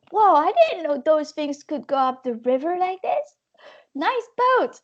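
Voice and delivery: high, wowed, rising to nice boat; impressed admiration. Retold or imitated. A paddler admiring a passing jet boat, high and wowed. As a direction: gush over the boat.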